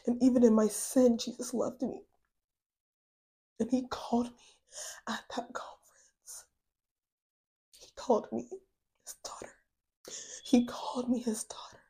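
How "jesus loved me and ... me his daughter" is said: soft, much quieter, partly whispered; hushed whisper. Real, not acted. Sharing with listeners how God called her his daughter, whispered. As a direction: let the words fall away to a breath. Emotional testimony, tearful hush.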